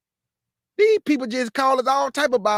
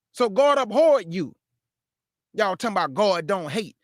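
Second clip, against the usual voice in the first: ordinary talk, nothing marked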